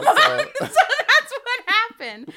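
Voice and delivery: high, loud, slow laughter; laughing. Real, not acted. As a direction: laugh the words out. Laughing reply.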